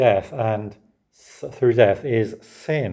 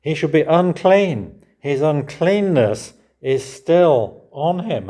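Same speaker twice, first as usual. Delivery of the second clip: louder, higher, slow proclamation; solemn slow reading; 7 semitones higher, 6 dB louder, slower than usual. Real, not acted. Preacher proclaiming uncleanness to the congregation, slow. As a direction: proclaim each word gravely.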